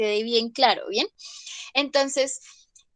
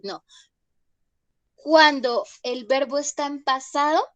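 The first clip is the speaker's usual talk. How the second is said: normal talking voice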